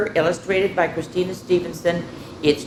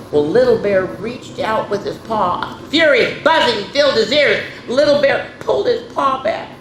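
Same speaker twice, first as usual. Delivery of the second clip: usual speaking voice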